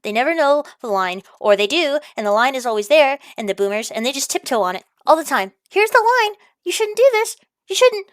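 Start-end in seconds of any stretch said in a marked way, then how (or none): 5.7-8.1 high, mocking schoolmarm warning; mock boomer voice. Ranting vlogger mocks cautious boomers in a high voice. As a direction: sneer it back as a prissy scold.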